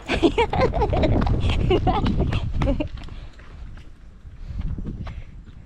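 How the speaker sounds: long unvoiced breathy laugh; airy drawn-out laugh. Real, not acted. Laughing at dog.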